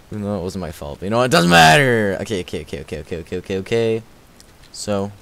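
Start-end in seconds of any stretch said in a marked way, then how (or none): 1.3-2.2 loud, higher exclamation; sounding like Captain Sparkles. Real, not acted. Streamer brushing off their own mishap, loud exclamation. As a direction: call it out big and high. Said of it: "it sounded like Captain Sparkles".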